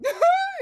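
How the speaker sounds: sudden high laugh bursting out; a loud sharp cackle; laughing out loud at the scene; burst of laughter. Real, not acted.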